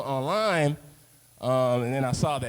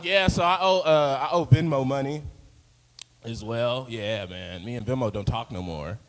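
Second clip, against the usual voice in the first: usual speaking voice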